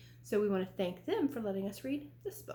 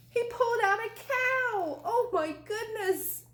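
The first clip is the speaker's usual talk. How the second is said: much higher, louder gasping wonder; amazed reveal; 10 semitones higher, 3 dB louder than usual. A narrator's reading. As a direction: sell the shock to the kids. Big reveal.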